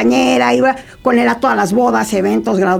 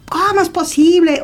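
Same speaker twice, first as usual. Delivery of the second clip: higher-pitched mock indignation; retold angry outburst; 6 semitones higher than usual. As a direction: retell an outraged protest, pitched up. (indignación imitada, en tono más agudo)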